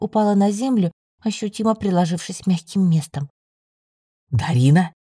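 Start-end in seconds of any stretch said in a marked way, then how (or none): 4.3-4.9 surprised low male voice; astonished young man, lowered voice. A narrator's reading. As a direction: call the name in deep-voiced surprise. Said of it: "раздался удивлённый знакомый голос".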